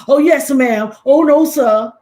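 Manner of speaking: loud, eager yes-ma'am deference; fawning deference. Retold or imitated. Mocking people who defer to authority, loud fawning politeness. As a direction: gush it like a people-pleaser before authority. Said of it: "they get to".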